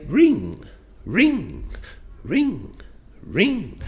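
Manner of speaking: "Ring" pitched high like a phone going off; lively RING intonation. Shown as a demonstration. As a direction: make each "ring" leap up high. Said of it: "but on ring we were, our voice was rising".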